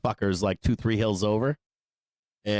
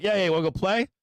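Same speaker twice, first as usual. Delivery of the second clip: high, eager voice of a dog playing innocent; playing-dumb dog voice; 8 semitones higher, much faster than usual. The shepherd pretending to fall for the coyotes, high and eager. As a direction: sound keen and naive, like you're falling for it. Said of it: "He'd be like".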